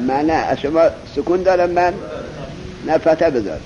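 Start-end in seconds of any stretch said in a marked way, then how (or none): none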